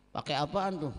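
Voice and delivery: normal talking voice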